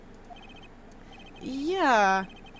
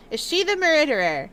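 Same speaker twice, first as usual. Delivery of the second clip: normal talking voice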